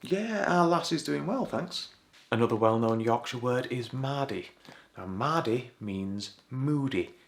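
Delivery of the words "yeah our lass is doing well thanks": cheery reply. Acted. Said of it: "How's your lass doing".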